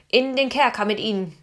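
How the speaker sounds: loud guard's call; guard calling out the sentence. A narrator's reading. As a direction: shout the order down the hall. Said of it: "rief der Schielende".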